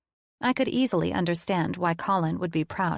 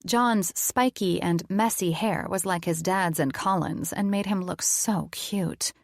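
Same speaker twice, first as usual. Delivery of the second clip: usual speaking voice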